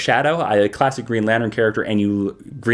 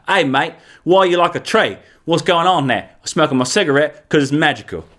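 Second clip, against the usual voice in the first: put-on hammy British accent; John Constantine. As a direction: do an exaggerated British "mate" voice. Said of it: "And John Constantine's like".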